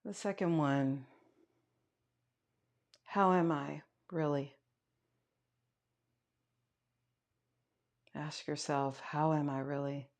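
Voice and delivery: quiet meditation guide, long pauses between prompts; guided meditation. Acted. Yoga teacher guiding students to notice their breath, guided meditation. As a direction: lead them softly inward, leaving space.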